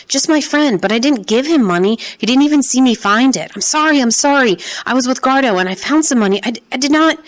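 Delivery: high, desperate pleading; pleading near tears. Acted. Boy begs the policemen, high and near tears. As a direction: beg them, close to breaking. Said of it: "I started to sob".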